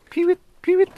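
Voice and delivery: high whistled peewit call; peewit bird call. Shown as a demonstration. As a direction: imitate the lapwing's cry. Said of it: "that comes from its call".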